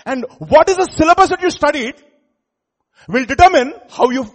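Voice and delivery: fiery loud preaching, high and loud. Acted. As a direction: thunder it from the pulpit. Preaching at full force.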